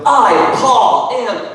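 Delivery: high, loud emphatic proclamation; hammed-up apostle declaring himself; playing Paul. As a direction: announce yourself grandly. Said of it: "And then Paul's like".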